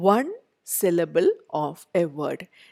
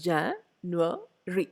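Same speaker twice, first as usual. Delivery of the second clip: "January" slowly split into Jan, ua, ry; syllable by syllable; 6 dB quieter, much slower than usual. Shown as a demonstration. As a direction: break "January" into three slow, separate beats. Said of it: "January is a three syllable".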